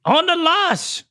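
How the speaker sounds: put-on high-pitched Irish accent; high, amateur Irish lilt. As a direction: announce the slang in mock Irish. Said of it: "You gotta use an Irish accent".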